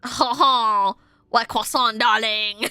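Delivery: loud, hammed-up French accent. Reader clowning as the French lover, mock French accent. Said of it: "how to do it in French accent".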